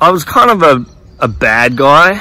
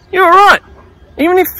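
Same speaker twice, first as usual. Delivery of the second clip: Jesus, cheerful; faster than usual. Retold or imitated. Jesus inviting Russell down from the tree, high cheerful. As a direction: beckon him down like an old friend. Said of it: "And he said".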